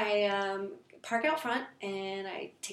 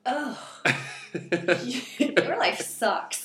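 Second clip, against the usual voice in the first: laughing retort; 2 semitones higher, 6 dB louder, much slower than usual. Retold or imitated. Inner retort to moms.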